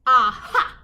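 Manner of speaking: loud triumphant shout. A narrator's reading. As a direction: burst out with a sudden idea. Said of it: "she shouted with a jump".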